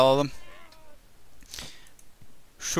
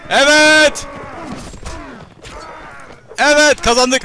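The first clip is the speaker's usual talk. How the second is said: loud, much higher victory cheer; excited cheer; 12 semitones higher, 5 dB louder, slower than usual. Real, not acted. Gamer cheers that the battle is won, excited. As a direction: cheer like you just won.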